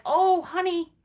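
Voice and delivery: a high, soothing "oh honey" like a parent; worried parent. Retold or imitated. Parent reacting to a child's self-harm, mock soothing. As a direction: imitate a parent softening at bad news. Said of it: "we're like".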